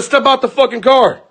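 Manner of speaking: high, heated demand; retold angry challenge. Husband challenging the boyfriend in the car, retold anger.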